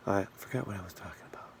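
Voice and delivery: quick whispered aside to himself. Real, not acted. Whispering to himself after losing his train of thought. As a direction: mutter it fast under the breath. Losing track mid-ramble.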